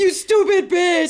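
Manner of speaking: high, drawn-out insult shout; hammed-up furious holler; yelling. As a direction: scream it slowly in a mock tantrum. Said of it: "him, like, yelling at her immediately".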